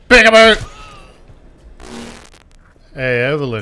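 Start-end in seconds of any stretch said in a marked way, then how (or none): 0.1-0.7 sudden high, loud peekaboo; spring it on them loudly; playful shout. Pretend. Gamer surprising an enemy, playful shout.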